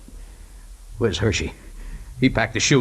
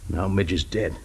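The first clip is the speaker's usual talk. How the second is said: quiet grief; 4 semitones lower, 8 dB quieter than usual. Acted. Pilot grieving dead friend.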